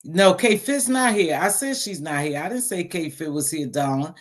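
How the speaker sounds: ordinary talk, nothing marked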